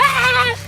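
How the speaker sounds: very high mock scream "Ah!"; girly scream. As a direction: imitate a shrill frightened shriek. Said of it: "you're like".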